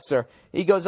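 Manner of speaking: ordinary talk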